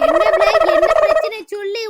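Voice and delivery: loud demand. Acted. Visitor demands to know the crying girl's problem, loud demand.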